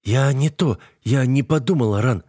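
high, flustered apologetic babble; guilty babbling. A narrator's reading. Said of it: "виновато лепечет Даян".

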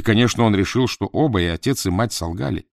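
ordinary delivery, nothing marked

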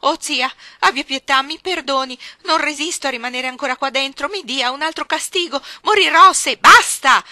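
put-on a child begging her aunt for mercy; desperate pleading. La bambina implora disperata. (la bambina implora la zia gridando disperata)

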